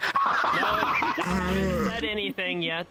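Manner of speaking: laughing. Real, not acted. Laughing denial.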